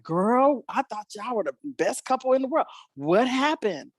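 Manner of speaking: high-pitched imitation of a disbelieving friend; incredulous friend. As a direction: act out a friend's stunned reaction. Retells friends' shocked divorce reaction.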